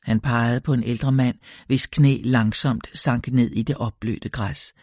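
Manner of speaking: ordinary delivery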